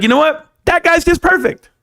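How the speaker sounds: hammed-up admiring fan voice, high and bright-eyed; mocking idealized fan. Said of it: "make you feel like".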